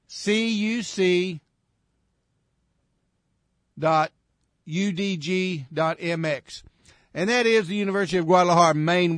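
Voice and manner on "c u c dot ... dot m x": slow, spaced-out letters with long pauses; dictating slowly. Real, not acted. Radio host repeating the web address for listeners, dictating slowly. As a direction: read each letter out deliberately for note-taking.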